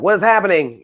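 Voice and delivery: high, loud imitation of startled disbelief; mock alarm. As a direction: act out a body caught off guard. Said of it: "I guess my body was like".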